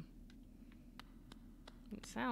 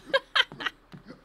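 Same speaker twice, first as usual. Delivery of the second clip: loud, high-pitched laughter; loud squealing laugh; high giggle; 10 dB louder than usual. Real, not acted. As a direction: crack up with embarrassed laughter. Laughing at own bad accent.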